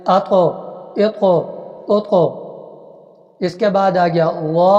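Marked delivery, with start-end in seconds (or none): 0.1-2.1 اق with the ق bounced, a short echo after the stop; teacher shows the ق in اق bounced off with qalqala; qalqala bounce. Shown as a demonstration. قلقلہ کے ساتھ اق. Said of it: "قلقلہ کر کے پڑھیں گے".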